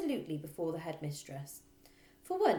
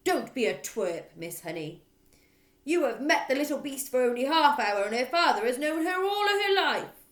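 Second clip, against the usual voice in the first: loud, higher raging; furious headmistress; 7 semitones higher, 11 dB louder than usual. Acted. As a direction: roar at her. Trunchbull voice.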